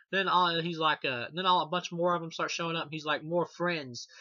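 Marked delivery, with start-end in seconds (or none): 3.3-4.1 imitating Pinhead. Quoting Pinhead's line.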